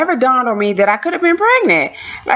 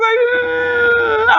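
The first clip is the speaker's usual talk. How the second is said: long, high-pitched whine; imitated drawn-out tearful whimper; crying whimper. Mimics crying while peeking round corner.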